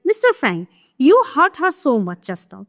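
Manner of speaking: slow, deliberate reading of a stage script; slow classroom reading of a play; play read aloud. Acted. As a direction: read each line out slowly for the class. Reads Mister Frank's line.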